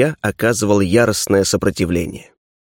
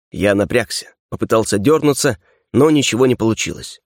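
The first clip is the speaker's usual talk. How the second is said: plain reading voice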